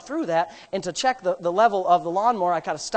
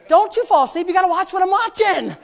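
mock warning; 10 semitones higher, 5 dB louder than usual. Mock warning to movie partner.

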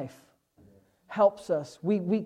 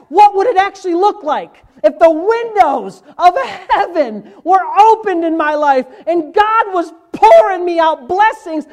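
higher, louder, slowing to the punchline; preaching at full force; 11 semitones higher, 12 dB louder than usual. Acted. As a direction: drive the point home from the pulpit.

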